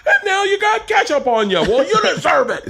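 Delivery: high, loud mocking scold. Scolding the ketchup-stained victim, high and loud mockery. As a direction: berate the victim in a sneering high voice.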